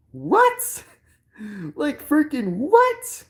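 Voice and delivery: high, squeaky comic "what"; mock disbelief. Streamer reacting to a toy's price in hammed-up disbelief. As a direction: shoot the pitch up in exaggerated bafflement.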